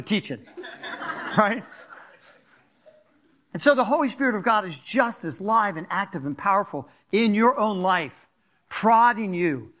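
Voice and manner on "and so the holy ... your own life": normal talking voice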